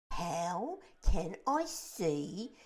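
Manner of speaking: slow, loud wounded retort; indignant earthworm. A narrator's reading. The earthworm retorts to James, offended. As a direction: spell it out with offence.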